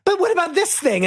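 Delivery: very high mock whine. Wife mocked mid-argument, very high childish whine. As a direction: squeal the question like a child. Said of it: "And then she's like".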